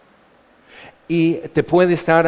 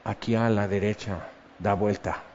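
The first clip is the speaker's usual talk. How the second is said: quiet directions; 5 semitones lower, 8 dB quieter than usual. Pretend. God quietly gives directions once he has your attention. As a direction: lower and much softer, like a calm instruction.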